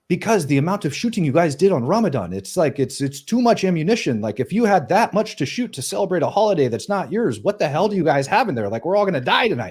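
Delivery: high-pitched, incredulous protest; acting out an exasperated, alarmed fighter; imitated alarmed objection. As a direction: argue in mock panic, pitched well up. Acted alarmed objection over the radio.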